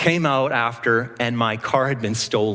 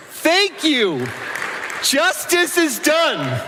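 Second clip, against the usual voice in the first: high, slow grandiose victory cry; hammed-up lofty gloating; mock triumph; 12 semitones higher, much slower than usual. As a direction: crow like a hero who won the day. Triumphant retold victory line.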